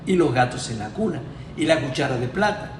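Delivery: ordinary talk, nothing marked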